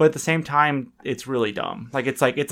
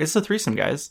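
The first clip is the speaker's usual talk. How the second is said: ordinary delivery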